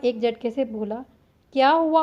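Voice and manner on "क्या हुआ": a sudden, louder, higher क्या हुआ; startled question, voice jumps up; surprised. A narrator's reading. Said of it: "Arya ने हैरत से". (चौंककर पूछना)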